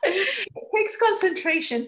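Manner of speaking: high, laughing through it. Real, not acted. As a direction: giggle while explaining. Laughing after the driving joke.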